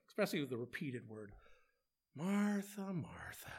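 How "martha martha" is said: gently fed up, letting the name fall away; exasperated. Retold or imitated. Jesus gently chiding busy Martha, mildly exasperated. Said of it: "this little voice of exasperation".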